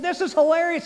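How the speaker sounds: high, loud burst of retold delight; hammed-up amusement; mock hilarity. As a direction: exclaim it high, thoroughly tickled. Boy delighted by being stuck bending.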